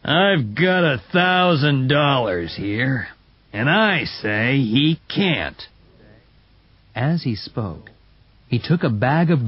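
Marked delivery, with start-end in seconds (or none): 0.0-5.7 slow, deliberate rich man; slow and loud. Acted. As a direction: spell it out slowly and heavily. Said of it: "speaking slowly and loudly".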